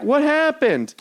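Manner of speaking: high-pitched mock clueless lady; retold call from car. Woman driver calls from her car, imitated high-pitched question. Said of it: "she was yelling it from her car".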